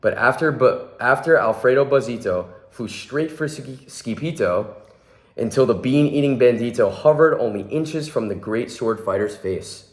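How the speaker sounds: ordinary delivery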